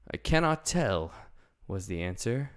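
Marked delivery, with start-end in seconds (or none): 0.1-1.1 higher, steady and kindly man; Tin Woodman's voice. Acted. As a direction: answer like a gentle tin man.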